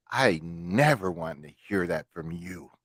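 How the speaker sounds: low, slow and soft scolding; imitating a mom's measured reprimand; mother's stern talking-to. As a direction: lay down the law as a mother, quietly. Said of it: "she goes".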